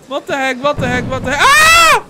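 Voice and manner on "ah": loud, very high shriek of fright; real jump-scare yelp; startled scream. Real, not acted. Jump scare in horror game.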